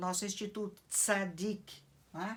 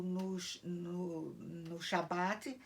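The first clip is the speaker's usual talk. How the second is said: ordinary talk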